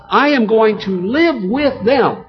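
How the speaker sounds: "I am going to live with them" high and slow; emphasized. Acted. Preacher quoting God in Revelation, emphasized. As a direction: weigh each word of "I am going to live with them". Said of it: "It's emphasized".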